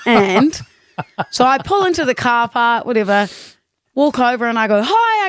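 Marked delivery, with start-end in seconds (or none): 4.8-5.3 higher bright hi; overly friendly greeting; retold eager hi. Retelling cheery greeting to stranger.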